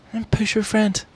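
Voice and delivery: high mock-innocent voice. Retelling his own denial.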